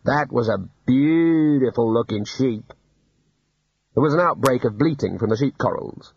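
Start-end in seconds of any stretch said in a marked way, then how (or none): 0.0-2.7 slow, wistful admiration; mildly wistful, drawn out. A narrator's reading. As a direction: linger longingly over the words. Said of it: "said one of the shearers wistfully".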